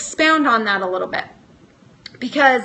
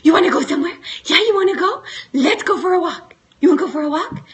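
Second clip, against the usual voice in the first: higher, louder mock yell of walk talk; excited dog coaxing; 5 semitones higher, 3 dB louder, faster than usual. Clapping and calling to Molly to tempt her with a walk, higher excited mock yell. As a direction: hype up the dog like it's walk time. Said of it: "I'm clapping and I'm yelling".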